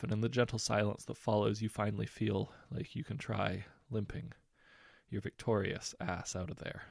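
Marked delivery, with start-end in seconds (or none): none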